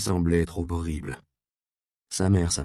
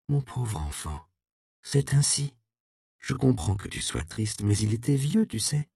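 tender mother; 3 semitones higher than usual. Acted. La mère console sa fille.

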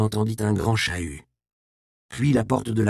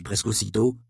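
plain reading voice

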